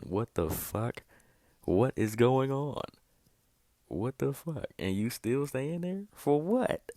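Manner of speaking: rising to a loud, high, incredulous shout; outraged disbelief. Real, not acted. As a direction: yell it like you can't believe what you're seeing. Outraged at Hitler rising to heaven.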